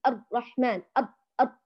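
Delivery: الرحمن with the r blocked short; choked-off ra. Shown as a demonstration. Teacher demonstrates trapping the sound, blocked r. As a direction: cut the sound off at أر. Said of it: "بحصر الصوت".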